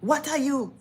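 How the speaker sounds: high, loud demand; imitated blunt lady. Lady confronting the model in a lineup, imitated blunt demand. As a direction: fire the question out, high and loud. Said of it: "the lady get to me and she's like".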